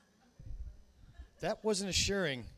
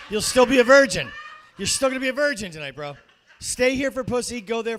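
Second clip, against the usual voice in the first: loud, laughing roast; laughing shout; 3 semitones higher, 10 dB louder than usual. Real, not acted. Host roasting the comic, laughing shout. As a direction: yell the punchline.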